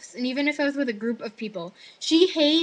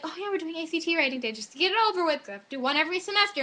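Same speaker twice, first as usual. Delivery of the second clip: quick, higher-pitched offhand announcement; breezy teacher; 5 semitones higher, faster than usual. Retold or imitated. Teacher announces the writing test, quick and breezy. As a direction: rattle it off as if it's nothing.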